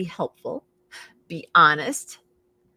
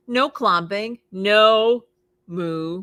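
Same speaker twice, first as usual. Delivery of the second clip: ordinary delivery, nothing marked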